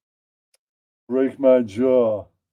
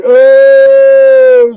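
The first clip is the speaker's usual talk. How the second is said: shrieked very high and loud; screaming friend; 12 semitones higher, 13 dB louder than usual. Retold or imitated. Friend screaming at the comic, shrieking. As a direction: let out a huge mock scream. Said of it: "And then he like".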